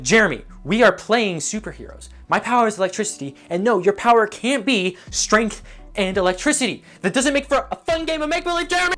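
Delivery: put-on indignant child, rising in pitch by the end; exasperated playground kid. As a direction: argue like an annoyed kid at recess. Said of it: "that kid on the playground who's always one-upping everyone".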